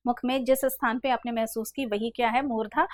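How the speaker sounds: ordinary talk, nothing marked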